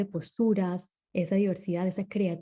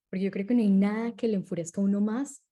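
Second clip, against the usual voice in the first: usual speaking voice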